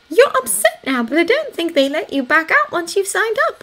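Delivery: high-pitched, concerned reasoning; warning. A narrator's reading. Anna warning Thomas he cannot back out, concerned. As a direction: press the caution in a high voice. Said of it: "she warned him".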